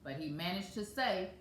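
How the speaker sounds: ordinary delivery, nothing marked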